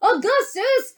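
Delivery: high-pitched motherly call. A narrator's reading. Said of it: "called out Missus Gloop".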